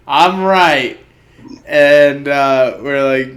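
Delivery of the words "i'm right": right pushed higher and louder, I'm right; triumphant insisting. Retold or imitated. Guy at the bar crowing he guessed right, louder. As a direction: crow I'm right like a winner.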